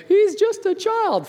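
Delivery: high trembling prayer, slow; a scared kid praying for a sibling; fearful plea. Retold or imitated. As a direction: plead in a frightened high voice. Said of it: "trembling in fear, praying".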